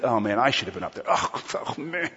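low, quick dejected groaning; mock a downcast self-blamer, low-voiced; condemned self-reproach. Preacher imitating people walking away from the microphone, condemned and low. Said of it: "walk away from that feeling condemned".

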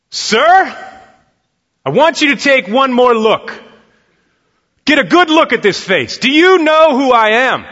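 loud, high insistent shout; yelling. Retold or imitated. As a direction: demand he look again, full voice. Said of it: "if you don't stop yelling at me".

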